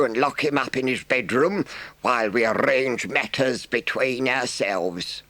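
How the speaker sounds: high, measured, firm badger voice; stern badger. A narrator's reading. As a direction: lay down the law. Badger decrees force.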